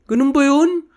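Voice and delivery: high, loud mock innocence; feigned surprise. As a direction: act shocked like you had no idea. Mimicking a caught Filipino playing dumb.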